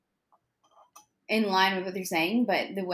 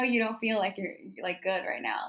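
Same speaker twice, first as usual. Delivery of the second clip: normal talking voice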